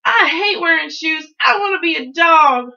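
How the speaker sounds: high, loud tantrum; child's shouted outburst; angry yell. A narrator's reading. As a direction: yell out every grievance, boiling over. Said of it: "I yelled".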